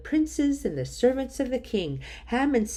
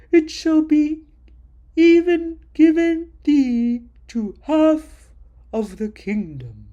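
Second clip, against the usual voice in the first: loud, booming royal voice, higher and slow; a king granting favor in a grand proclaiming tone; 4 semitones higher, 9 dB louder than usual. Acted. King offers Queen Esther half his kingdom, booming and grand.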